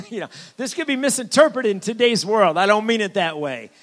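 amused aside through a laugh. Real, not acted. Laughs at own remark.

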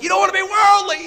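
high, loud imitation of a scolding preacher; mock camp preacher. Retelling camp preachers scolding teens, mock preacher voice. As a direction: ham up the fire-and-brimstone preacher. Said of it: "they'd preach on that stuff".

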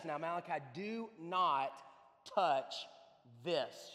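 measured warning, a pause before each key word; stern warning. Retold or imitated. Mother warns Malachi off the cookie sheet, stern and deliberate. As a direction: space out the words like a firm parent.

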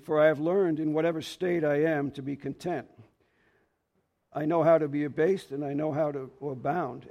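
measured, earnest scripture reading. Acted. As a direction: read the verses as holy words. Reads scripture aloud.

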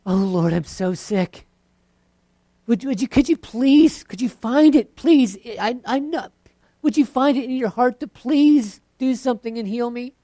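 high, loud whiny begging; a preacher mocking a begging prayer, high and whiny. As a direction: plead pitifully with God, voice climbing high. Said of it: "Then you might have the begging".

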